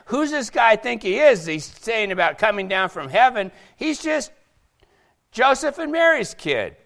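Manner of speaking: high-pitched, incredulous dismissal; scornful crowd. Acted. Crowd doubting Jesus came from heaven, scornful. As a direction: scoff at his claim, pitched well up. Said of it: "'Cause they'd already said".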